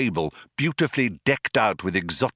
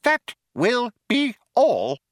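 high, slow, emphatic mouse; Benji, firm; 4 dB louder, slower than usual. A narrator's reading. Mouse ends the discussion, firmly. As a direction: dismiss him word by word. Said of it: "said Benji firmly".

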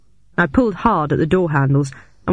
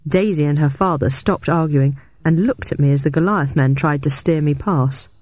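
usual speaking voice

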